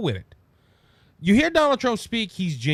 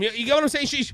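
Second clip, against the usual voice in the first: ordinary delivery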